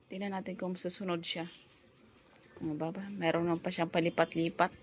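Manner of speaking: normal talking voice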